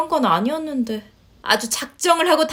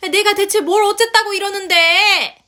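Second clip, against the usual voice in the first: exasperated protest; 8 semitones higher, 4 dB louder than usual. Acted. Mother protests to her son.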